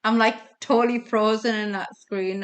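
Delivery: ordinary talk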